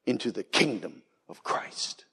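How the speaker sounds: hushed whisper; whispered close. Real, not acted. Preacher closing on paradise, in a whisper. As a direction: let it fall to a breath. Climax fading to hush.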